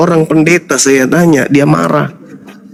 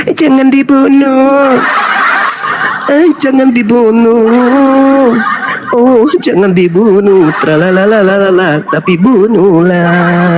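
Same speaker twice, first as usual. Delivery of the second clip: slow, drawn-out sly devil; mock plea, stretched into singsong; 4 semitones higher, slower than usual. Preacher playing the devil among the crowd, insincere singsong plea. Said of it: "kira-kira suara iblisnya apa?".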